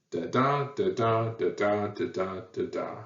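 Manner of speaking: da da with the second beat stressed each time; rhythmic meter demo, fading at the end; iambic beat. Shown as a demonstration. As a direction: tap out the meter, weak then strong. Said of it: "That's iambic pentameter".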